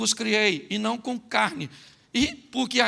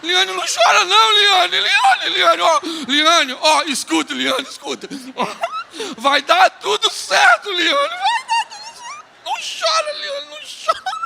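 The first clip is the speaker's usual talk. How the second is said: higher, much louder panicked calling of her name; frantic husband shouting; 9 semitones higher, 7 dB louder, slower than usual. Retold or imitated. Preacher acts out a young husband shouting at his crying wife, frantic and loud. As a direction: yell at her to stop crying, hammed-up. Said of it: "Ó o marido".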